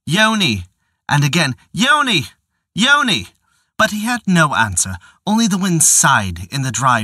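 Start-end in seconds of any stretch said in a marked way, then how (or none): 0.1-3.3 calling higher each time. Acted. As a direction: call her name across the grove. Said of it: "He called".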